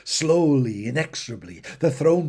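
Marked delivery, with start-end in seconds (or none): none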